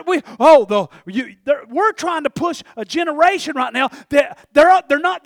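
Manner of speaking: ordinary delivery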